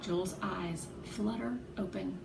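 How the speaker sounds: usual speaking voice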